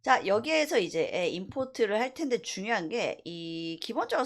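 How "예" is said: ordinary talk, nothing marked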